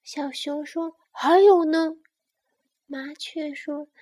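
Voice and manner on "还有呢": loud, higher, hungry for more; eager demand. Acted. Bear wants more about mama, eagerly asking. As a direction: press eagerly as the delighted cub.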